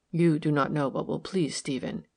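plain reading voice

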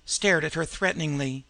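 ordinary delivery, nothing marked

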